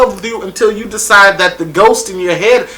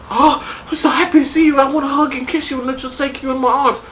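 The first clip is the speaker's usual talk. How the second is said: put-on gushing girl; 9 semitones higher, 3 dB quieter, faster than usual. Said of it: "she's like".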